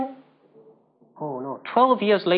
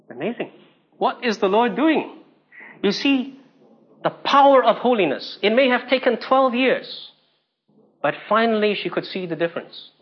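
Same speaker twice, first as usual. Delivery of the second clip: ordinary talk, nothing marked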